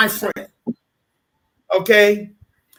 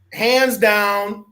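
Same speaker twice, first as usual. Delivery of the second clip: plain reading voice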